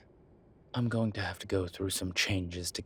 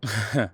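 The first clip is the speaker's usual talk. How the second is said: laugh; 6 dB louder than usual. Acted. Amused demon chuckles.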